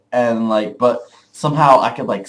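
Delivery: ordinary talk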